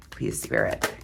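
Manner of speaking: softly whispered prayer to Spirit; whispered plea. Real, not acted. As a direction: whisper it under your breath. Silent plea while pulling card.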